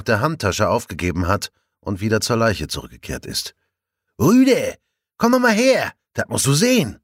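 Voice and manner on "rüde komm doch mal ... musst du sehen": calling out. A narrator's reading. Colleague calls from the body.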